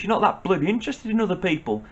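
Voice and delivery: ordinary talk, nothing marked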